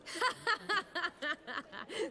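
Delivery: a bright little laugh before answering; light laugh. Acted. Amused at Geralt's question.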